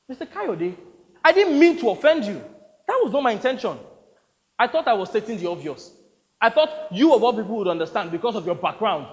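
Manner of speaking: high, loud self-justification; defensive protest. Acted. Explaining the remark to an offended actor, defensive. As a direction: plead that no offence was meant.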